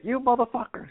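imitating friends' stunned exclamation; mock outraged surprise. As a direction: squeal it in disbelief. Friends see the big buck.

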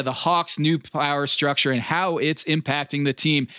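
ordinary delivery

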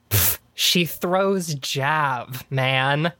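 slow, knowing drawl with a scoff. Retold or imitated. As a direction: stretch it out like the answer is obvious. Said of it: "And he goes".

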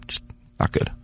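whispered, curt postgame answer; whispered mock answer. Imitating a player's curt answer to a reporter, whispered. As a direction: breathe it out like a grumpy player.

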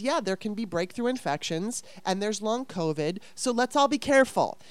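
ordinary delivery

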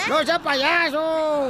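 drawn-out mock scolding. Real, not acted. Repite el reclamo, alargado.

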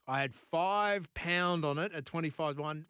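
usual speaking voice

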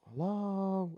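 high, calling Hello into the dark. Retold or imitated. Alone in the dream, calling toward the crying. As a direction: call out to an unseen crying child. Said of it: "and I go".

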